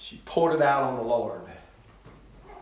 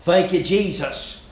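normal talking voice

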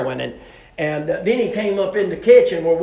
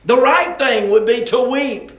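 loud, high, insistent sermon voice; impassioned preacher at full volume; forceful preaching; 6 semitones higher, 8 dB louder than usual. Real, not acted. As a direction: press the point on the congregation.

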